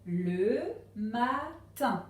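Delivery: LE MATIN very slowly as le... ma... tin; syllable-by-syllable reading. Shown as a demonstration. Teacher reading the first word for pupils, LE MATIN in slow syllables. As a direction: stretch and separate each syllable of LE MATIN. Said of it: "essayer de lire le premier mot".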